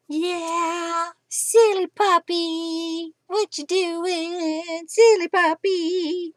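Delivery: drawn-out cooing to a puppy; puppy talk. Real, not acted. Crafter coos at her silly teething puppy, high and slow.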